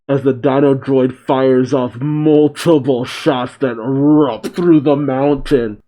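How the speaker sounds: normal talking voice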